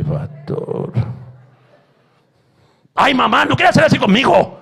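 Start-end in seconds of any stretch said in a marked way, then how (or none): 2.9-4.6 louder, whiny mock son; imitating a son talking back; mocking defiant son. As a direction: sass it like a rebellious teen.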